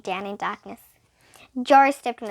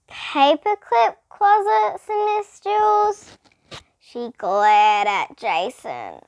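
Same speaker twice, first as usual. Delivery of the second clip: higher, very slow, pointed; slow accusing; 6 semitones higher, 5 dB louder, much slower than usual. A narrator's reading. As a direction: drag it out with a glare.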